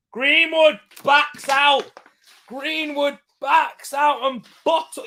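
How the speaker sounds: high, drawn-out cry of disgust at the player; real exasperation. Real, not acted. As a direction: wail it slowly in match-day frustration. Exasperated reaction to missed chance.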